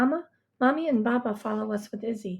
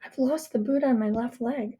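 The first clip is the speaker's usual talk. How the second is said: ordinary delivery, nothing marked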